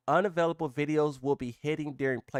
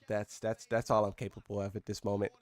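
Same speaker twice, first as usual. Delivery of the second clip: ordinary talk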